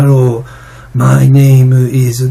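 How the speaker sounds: halting, syllable-by-syllable katakana English; stiff Japanese-accented English. Shown as a demonstration. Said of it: "片言日本語、なんか、ぽく、ぽい英語で".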